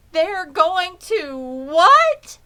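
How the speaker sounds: high, loud, slow disbelieving shriek; shocked scream. Acted. Wilbur learns he will be killed, screaming high and slow. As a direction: shriek it out in horror. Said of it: "screamed Wilbur".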